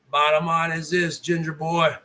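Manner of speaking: hushed low warning to the streamer; quiet and low. Real, not acted. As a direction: drop the voice low and soft before the blow. Rant, low repeated build-up.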